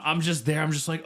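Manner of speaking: ordinary talk, nothing marked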